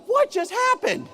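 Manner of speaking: high, stunned inner voice; inner disbelief. Retold or imitated. Teacher's inner thought after Justin speaks, high stunned disbelief. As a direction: play the teacher's shock inside his head. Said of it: "Inside, I'm like".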